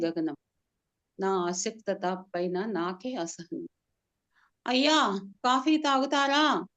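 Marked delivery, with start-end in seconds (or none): 4.7-6.6 higher and louder, calling into an ear. Acted. Servant shouts into the sick master's ear, loud call. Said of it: "గట్టిగా అరుస్తున్నాడు".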